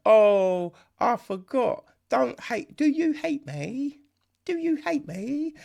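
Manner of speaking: high, hammed-up needy whine; imitating a clingy sister; mock pleading. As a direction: plead like a bad actress. Mocking the sister's lines.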